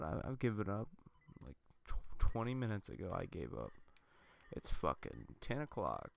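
ordinary talk, nothing marked

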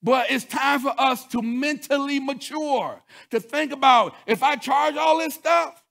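loud, high preaching, hammering each point; full-force preaching. Acted. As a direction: preach it hard to the congregation. Preacher rises on credit card story.

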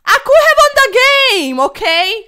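high, loud outburst of regret; exasperated shout. Real, not acted. Streamer yelling about the game he lost, exasperated. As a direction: yell the regret at the screen.

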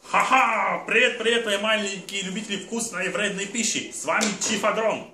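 high, loud showman alter ego. Pretend. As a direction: bounce in as a brash cartoon chef. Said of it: "мой соведущий, а именно моё альтер-эго, э, Чиф Адрон".

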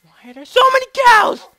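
very loud, high outburst; sudden over-the-top holler; exasperated yell. Pretend. Player blocked by crowds of cows, exasperated yell.

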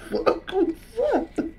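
stunned disbelief. Real, not acted. Reacting to the shark clip, he asks in high, soft disbelief. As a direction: ask it as if you can't believe it.

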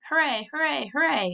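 excited shouted hoorays; children cheering. A narrator's reading. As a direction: cheer like kids on a wagon. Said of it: "The Woodlawn children shouted too".

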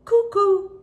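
high, loud sung-out cuckoo, falling; cuckoo call. Retold or imitated. Narrator imitating the cuckoo overhead, high bird call. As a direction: imitate the bird's two-note call. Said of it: "came the call again".

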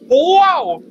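a loud, high, amazed wow. Real, not acted. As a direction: burst out in real astonishment. Reacting loudly to the sign.

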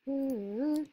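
short high hum to oneself; idle little hum; absent-minded humming. Real, not acted. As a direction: hum idly while playing. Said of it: "I just gotta stop summing".

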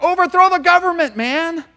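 high, loud mock rebel yell; hammed-up crowd demanding revolt; rowdy rebel. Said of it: "They're like".

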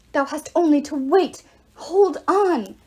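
loud, high, grand urging; talking arrow. A narrator's reading. Arrow to fading Apollo, loud commanding encouragement. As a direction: exhort like an archaic herald. Arrow urges hero to hold on.

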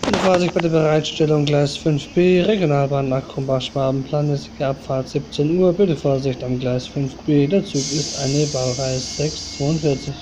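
station announcer reading train times; calm, even railway tannoy read; flat platform announcement. Acted.

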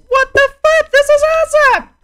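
high, loud gamer outburst; excited yell. Real, not acted. Gamer reacting to a new game, excited yell. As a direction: yell it high in amazed delight.

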